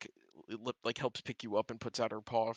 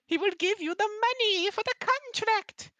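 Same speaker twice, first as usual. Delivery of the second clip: very high, loud, eager helper; little owl; 8 dB louder than usual. Acted. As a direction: chirp it out like a thrilled little clerk. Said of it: "the little owl goes".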